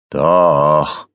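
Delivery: drawn-out, grudging reply; annoyed. A narrator's reading. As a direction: concede it, clearly irritated. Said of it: "gab Tannenberg genervt zurück".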